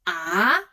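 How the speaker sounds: "ah" starting low, sweeping up; "ah" with a steady climb; rising second tone. Shown as a demonstration. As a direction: glide "ah" upward like a question. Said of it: "The second tone is about racing".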